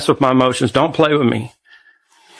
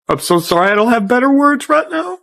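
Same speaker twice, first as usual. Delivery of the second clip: choking up while apologizing, much higher; voice breaking into tears; 10 semitones higher than usual. Real, not acted. Father apologizing to his daughter on video, voice cracking into tears. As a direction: pitch climbing as his voice cracks with crying. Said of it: "I'm hurting so bad".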